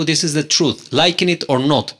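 usual speaking voice

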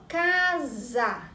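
"casa" said slowly, with the S sounding like Z; teacher sounding out "casa" slowly; slow demo word. Shown as a demonstration. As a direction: stretch out "casa" so the Z sound is clear. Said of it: "o S tem o som de S".

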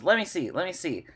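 lightly voiced eager chicken; impatient hen. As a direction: push in like a nosy hen. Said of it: "Sunflower hops in and goes".

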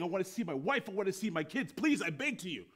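high-pitched, frantic pleading; acted panicked plea; mild mock screaming. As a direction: beg desperately, running on, without a full scream. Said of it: "the guy's screaming and yelling".

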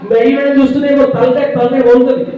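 loud, menacing parental threat; mock a mother's horror-film rant; imitated scolding mother. Parent threatening a child like a horror-film character, loud mock scolding. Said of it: "ఇట్లా Chandramukhi Kanchana one తల".

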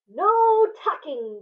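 loud, slow chant; crew crying out. Acted. Crew cries out against tucking, loud and slow. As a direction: drag it out as a crew. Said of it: "the crew cried".

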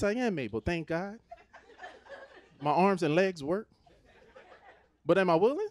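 ordinary talk, nothing marked

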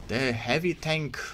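put-on hammy Russian commander accent. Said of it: "wannabe Russian voice acting impression by me".

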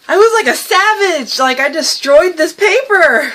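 high, loud, thrilled disbelief; worked-up exclamation. Real, not acted. Vlogger showing viewers the destroyed page, worked up. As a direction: exclaim it high, drawing out the end.

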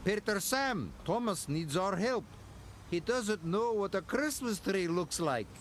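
small cheeky engine calling out; calling out cheekily. Acted. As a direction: shout the tease across the yard. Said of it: "Duncan called out".

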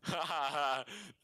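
laughing pig snort; snorting pig noise; pig sound. Acted. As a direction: honk like a pig through a laugh. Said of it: "why did you just make a fucking pig sound".

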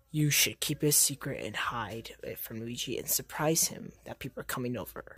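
lightly voiced hero giving secret advice; Spider-Man voice. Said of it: "Spider-Man, please tell me".

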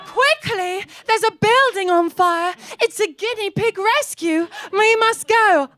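put-on rally the troops like a head girl; mock heroic urgency. Perfect girl leading a rescue.

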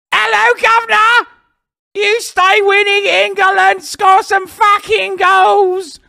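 high, shouted British football-fan cheer; over-the-top British yelling; mock British accent. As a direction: bellow a hammed-up British greeting, slow and drawn out. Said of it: "Oi Bruv that has come in here and been like".

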